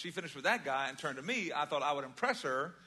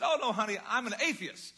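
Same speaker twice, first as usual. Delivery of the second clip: smiling reply; 7 semitones higher, 6 dB louder than usual. Retold or imitated. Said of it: "big smile".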